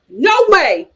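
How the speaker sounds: usual speaking voice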